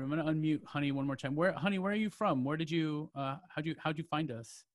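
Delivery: normal talking voice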